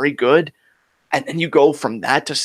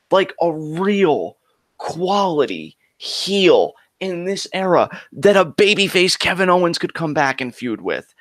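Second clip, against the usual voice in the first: usual speaking voice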